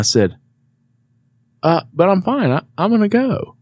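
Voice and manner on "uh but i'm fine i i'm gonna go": high, hesitant retold objection; mock pleading protest. Patient handed a jug by doctor, feebly insistent. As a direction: imitate wheedling your way out the door.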